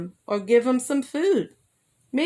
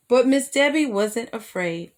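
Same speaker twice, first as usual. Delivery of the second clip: ordinary delivery, nothing marked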